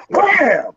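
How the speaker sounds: sharp high loud Wham!; impact sound. Retold or imitated. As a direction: slam it out like a hit landing. Retelling the sneak attack.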